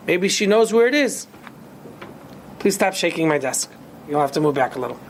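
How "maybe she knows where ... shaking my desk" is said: ordinary delivery, nothing marked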